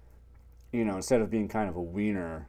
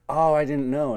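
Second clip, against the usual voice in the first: louder, higher fake innocence; mock innocent; 6 semitones higher, 6 dB louder than usual. [name] faking ignorance of the allergy, louder. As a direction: play the innocent hero.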